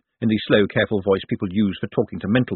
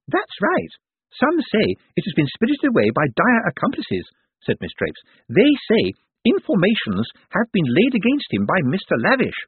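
gossiping nurse; 8 semitones higher than usual. A narrator's reading. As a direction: relish every rumour.

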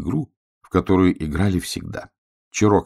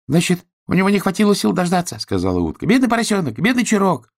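little duck voice; 11 semitones higher, 3 dB louder, faster than usual. Acted.

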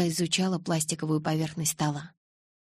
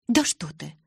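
sarcastic; 4 dB louder than usual. A narrator's reading. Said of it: "съязвила я".

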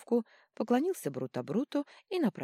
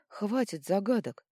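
slow, exasperated outburst; losing patience; 5 semitones higher, 4 dB louder than usual. A narrator's reading. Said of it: "не выдержала Вителья".

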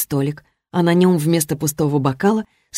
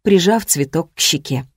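plain reading voice

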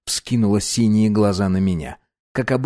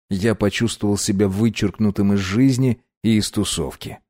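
usual speaking voice

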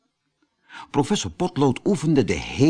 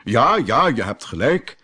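a bit impatient. Acted. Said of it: "een beetje ongeduldig".

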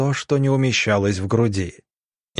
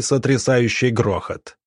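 plain reading voice

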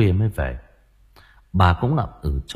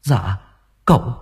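high, halting, fearful voice climbing higher; terrified stammer; 12 semitones higher than usual. Acted. Servant telling the mother her son fell, terrified. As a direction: dread of telling the mistress.

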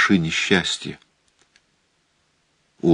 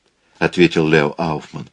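ordinary delivery

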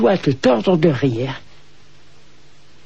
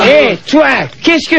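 calling out; 6 semitones higher, 3 dB louder, much slower than usual. Acted. Intruder spotted, called out.

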